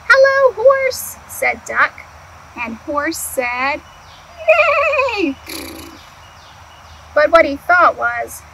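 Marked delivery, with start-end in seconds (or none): 0.1-1.2 high, slow greeting as Duck; cheerful duck greeting. Acted. Duck greeting Horse, high and drawn out. 4.5-5.4 high neigh like a horse. Acted. Horse answering Duck with a neigh.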